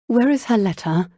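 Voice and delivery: mildly frantic, demanding to know. A narrator's reading. Jane asks Lucy for Isabella's letter, frantically. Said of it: "Jane asked frantically".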